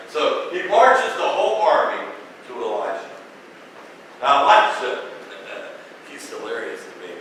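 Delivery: normal talking voice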